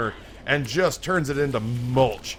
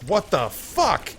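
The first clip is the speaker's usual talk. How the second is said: slow, higher-pitched stunned disbelief; dumbfounded; 7 semitones higher, much slower than usual. Acted. Patient shown a gremlin by his doctor, dumbfounded. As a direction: draw it out, voice rising in disbelief.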